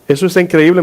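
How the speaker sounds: ordinary talk, nothing marked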